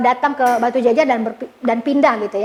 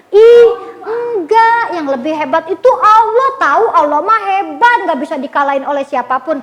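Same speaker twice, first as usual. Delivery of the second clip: Banu; 3 semitones higher, 5 dB louder than usual. Retold or imitated. Boy defends Allah to his friend, stubbornly. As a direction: argue back like an indignant little boy. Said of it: "dia ngotot ngebela Allah".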